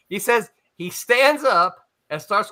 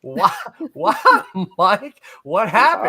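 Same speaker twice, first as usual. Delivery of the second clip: retold alarm; 3 semitones higher, 6 dB louder, slower than usual. Acts his own alarmed questioning.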